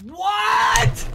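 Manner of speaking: ordinary delivery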